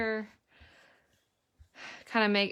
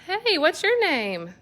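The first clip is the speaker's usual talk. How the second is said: high playful greeting; 10 semitones higher, 5 dB louder, slower than usual. Real, not acted. Mom greets sock puppet playfully.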